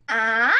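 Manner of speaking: "ah" starting low and rising sharply, loud; second tone. Shown as a demonstration. Teacher repeating for learners, "ah" rising from low. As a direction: lift "ah" as if asking. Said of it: "So for the second tone".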